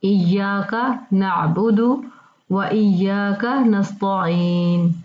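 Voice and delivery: very slow, careful Quranic recitation. Shown as a demonstration. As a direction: spell it out word by word in recitation. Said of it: "तो ये उर्दू तलफ़ुज़ हो गया". (बहुत आहिस्ता तिलावत)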